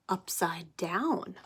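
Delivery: usual speaking voice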